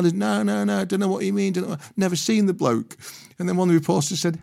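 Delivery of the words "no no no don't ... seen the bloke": higher, innocent mock denial; Harry Redknapp denying. Harry denying to reporters again, lightly voiced innocence. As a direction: play dumb, breezily.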